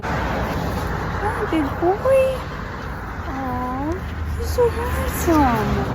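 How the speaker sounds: high cooing over a puppy. Real, not acted. Cooing at a dog photo.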